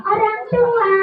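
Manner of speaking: high puppet echo; puppet character. Acted. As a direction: parrot it back in puppet voice. Puppet repeats presenter's correction.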